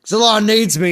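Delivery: high, loud heroic mock cry; imitating Gary. Streamer imagines Gary rushing home, high heroic mock cry. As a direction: play Gary dropping everything to answer. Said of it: "He's like".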